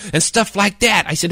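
ordinary delivery, nothing marked

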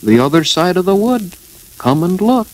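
high, firm small voice. A narrator's reading. Piglet directs Eeyore to the house, firm. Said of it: "said Piglet firmly".

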